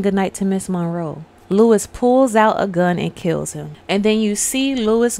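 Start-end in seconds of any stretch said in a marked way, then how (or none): none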